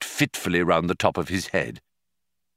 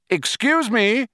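shouted; 14 semitones higher, 3 dB louder, slower than usual. A narrator's reading. Ford hailing Prosser across the mud, high shout. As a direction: call it out high across the site. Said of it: "he shouted".

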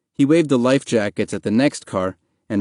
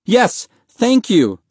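high, loud, drawn-out thanks; excited cry; 11 semitones higher, 5 dB louder, much slower than usual. A narrator's reading. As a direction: cry out eagerly in gratitude. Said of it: "Mahmoud cried".

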